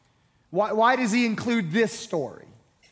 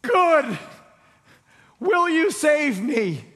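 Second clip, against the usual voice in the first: higher, louder, panicked call for help; desperate plea; 6 semitones higher, 4 dB louder, much slower than usual. Acted. Man dangling from a branch calls up to God, high and frantic. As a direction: beg upward, slowing on the plea. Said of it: "He says".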